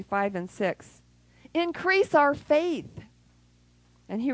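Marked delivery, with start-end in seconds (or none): 1.5-3.0 high, slow pleading cry; pleading plea. Acted. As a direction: beg for more faith, drawn out. Apostles pleading to Jesus.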